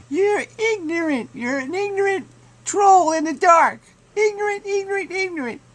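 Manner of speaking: mocking taunt. Mocking the opponent in a squeaky high falsetto taunt. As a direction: squeal it like a jeering child.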